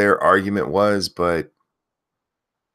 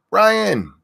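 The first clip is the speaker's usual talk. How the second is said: loud, high name call-outs; yelling names; 12 semitones higher, 4 dB louder than usual. Real, not acted. Host yelling chat names to early viewers, loud and high. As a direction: belt each viewer's name out. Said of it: "if you want me to yell your name".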